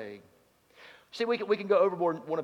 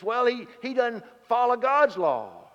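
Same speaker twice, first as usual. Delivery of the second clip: mock Pharisee objection; 7 semitones higher, 5 dB louder than usual.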